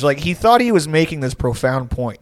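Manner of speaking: ordinary delivery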